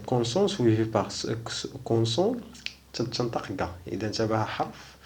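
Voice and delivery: ordinary delivery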